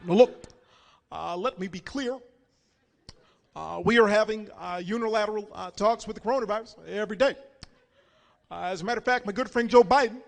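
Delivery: poised presidential address with long uh pauses; Barack Obama. Acted. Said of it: "Barack is very poised".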